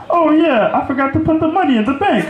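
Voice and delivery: high-pitched, quick excuse; mock casual reply. Comic answering the caller about the check, high and quick.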